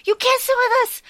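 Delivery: high, indignant mean-girl impression; Gretchen. Quoting the mean girl banning her queen, high-pitched and indignant. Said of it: "we have Gretchen being like".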